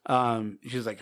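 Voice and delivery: ordinary talk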